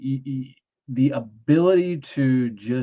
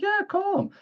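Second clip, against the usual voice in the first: much higher, a hammed-up helpful housewife; mock lady voice. Homeowner lady telling him to call his boss, high mock voice. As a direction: squeak it out like the eager homeowner.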